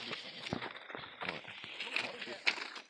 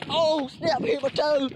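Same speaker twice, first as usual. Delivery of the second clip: startled yelp; 7 semitones higher, 5 dB louder, slower than usual. Real, not acted. Hurt toe while running.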